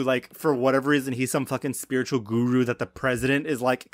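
ordinary talk

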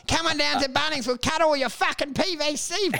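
high, upbeat shop-worker pitch; mock cheerful store clerk; cheery salesman. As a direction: sell it like a chirpy hardware ad. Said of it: "they're like".

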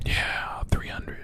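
soft whispered reply; whispering. Real, not acted. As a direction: whisper it back. Whispering back.

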